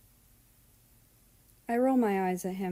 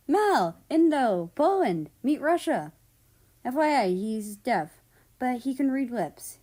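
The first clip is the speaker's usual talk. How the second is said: higher and louder, calling out each name; excited introductions; 5 semitones higher, 7 dB louder than usual. Acted. America introducing Russia to the newcomers, excited and loud. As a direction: announce the friends with eager energy.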